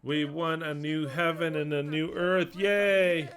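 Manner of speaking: high, drawn-out mock cheering; sing-song mock jubilation; applauding, cheering believers. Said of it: "you have Peter applauding".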